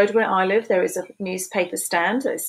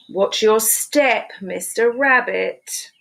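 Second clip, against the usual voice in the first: slow title read; 3 dB louder, much slower than usual. Shown as a demonstration. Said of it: "announcing title slowly".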